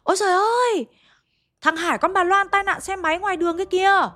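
cao vút và to hơn, gọi báo tin dữ; la lên báo tin cho cả xóm; hô hoán ầm ĩ. Retold or imitated. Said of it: "hô hoán ầm ĩ cả xóm". (người đi đường hô hoán báo tin tai nạn, giọng cao hốt hoảng)

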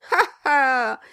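short performed laugh; forced-sounding laugh. A narrator's reading. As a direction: laugh it out as spelt, in character. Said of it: "dit en riant monsieur Brass".

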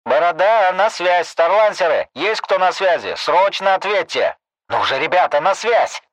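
high, loud calling into the radio; urgent radio call. Acted.

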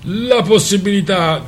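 sarcastic rally-speech imitation; mock pathetic tone. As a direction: ape the speaker's solemn pathos to drown him out. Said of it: "imitando il tono patetico di Svoboda".

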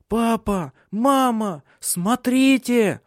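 put-on high-pitched excited child calls; children shouting. A narrator's reading. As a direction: call out to parents in alarm, stretching the last word. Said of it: "кричали они". (высокий детский крик)

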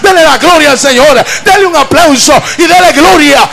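loud, fervent shouted preaching, slowing on the big lines; preacher roaring praise to the church; full-force preaching. Acted. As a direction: rouse the congregation with full-throated praise.

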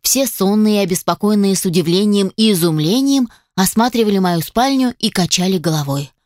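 plain reading voice

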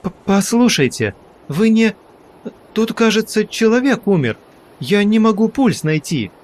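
high, halting panicked plea; stammering, frightened. Acted. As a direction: stumble over words in fright. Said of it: "сбиваясь".

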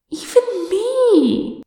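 very high, drawn-out squawk. A narrator's reading. Kinsman learning he is noticed too, squawking. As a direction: shoot up high in startled disbelief. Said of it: "Freyrin squawked".

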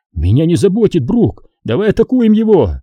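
high, rash call to attack; reckless urging. A narrator's reading. Obi-Wan urging Qui-Gon to attack, reckless. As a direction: urge him on, pitch climbing. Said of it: "заорал Оби-Ван с безрассудством в голосе".

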